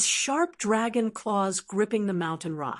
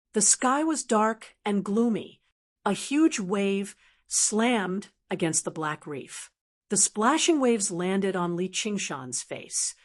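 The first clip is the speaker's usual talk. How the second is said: usual speaking voice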